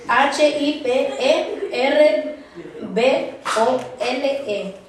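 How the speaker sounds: slow letter-by-letter spelling; spelled out slowly. Shown as a demonstration. Teacher spelling hipérbole for students, slow and separated.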